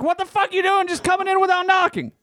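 high-pitched retold outrage; indignant outburst. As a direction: act out scolding an intruder, voice way up. Retold scolding of the intruder.

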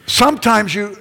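high, loud, broken-off accusation; accusing retort. Retold or imitated. Husband tries a weaker accusation on his wife, insistent.

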